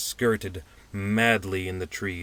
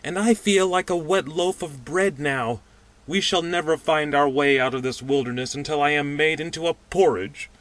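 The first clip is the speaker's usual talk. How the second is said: moaning; 8 semitones higher, 6 dB louder than usual. Acted. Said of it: "said the pudgy man moaningly".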